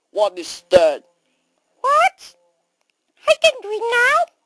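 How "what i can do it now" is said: very high, quick mouse chatter. Acted. Mouse asking about watching the movie, quick mouse squeak. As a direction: squeak it fast like a tiny mouse. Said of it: "no more mouse voice".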